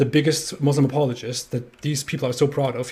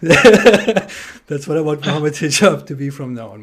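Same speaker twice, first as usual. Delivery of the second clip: chuckling through the words, a little slower; laughing; 7 dB louder than usual. Real, not acted. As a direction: keep laughing as you speak.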